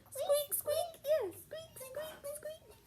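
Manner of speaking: high, tiny mouse squeaks; squeaky mouse. Shown as a demonstration. Teacher shows a child how a mouse squeaks. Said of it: "They go".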